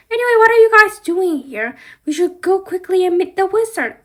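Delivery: high, loud and fast; eager, fast, high urging; excited hurry. Pretend. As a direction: rush everyone off to the wizard. Doll character hurrying friends.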